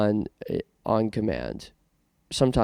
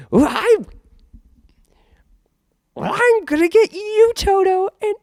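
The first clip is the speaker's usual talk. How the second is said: shrieking, much higher and louder witch cackle-voice; Wicked Witch impression; 9 dB louder than usual. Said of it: "Wicked Witch of the West".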